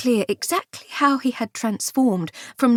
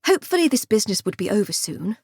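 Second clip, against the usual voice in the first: ordinary delivery, nothing marked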